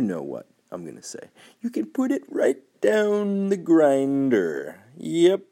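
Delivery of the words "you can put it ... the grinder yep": hammed-up flourish. Podcast segment bit, the grinder.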